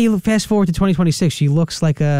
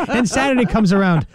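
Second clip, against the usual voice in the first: ordinary talk, nothing marked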